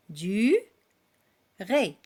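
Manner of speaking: Du, then ré, split into two separate beats; syllables split apart. Shown as a demonstration. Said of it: "on entend deux syllabes". (syllabes bien détachées)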